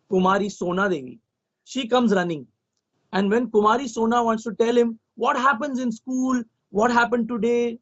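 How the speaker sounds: plain reading voice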